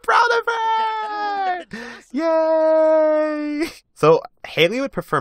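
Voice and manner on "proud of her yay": very high, drawn-out happy squeal; proud cheering. Real, not acted. Player proud of Haley's win, squealing cheer.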